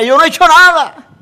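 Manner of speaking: high, loud indignant protest; Job protesting innocence. Acted. Job protesting loudly to his accusing friends. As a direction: cry out that you did nothing wrong. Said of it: "Job decía".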